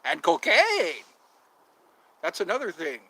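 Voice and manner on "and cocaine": slow, loud, voiced-up punchline on cocaine; comic punchline about selling cocaine; deadpan punchline. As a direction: drop it like a joke reveal.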